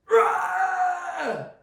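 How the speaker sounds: high, loud screaming roar; lion roar. Retold or imitated. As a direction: let out a huge roar. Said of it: "He roared again".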